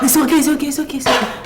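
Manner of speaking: loud, rushed it's okay over and over; calm her while panicking yourself; frantic reassurance. Acted. To the laboring girl, frantic loud reassurance.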